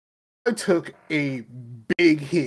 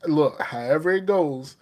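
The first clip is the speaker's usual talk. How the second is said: normal talking voice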